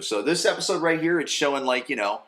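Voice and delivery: normal talking voice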